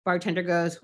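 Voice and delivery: ordinary delivery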